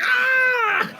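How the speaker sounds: very high yelp of pain; pained cry. Acted. Game master as gnome hit by cane, pained scream. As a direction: scream as if struck from behind.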